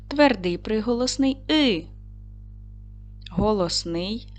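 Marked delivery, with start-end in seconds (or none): 1.5-1.9 "И" as a lone vowel; "И" cleanly separated; isolated sound. Shown as a demonstration. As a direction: sound out "И" by itself. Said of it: "виконаємо звуковий аналіз слова".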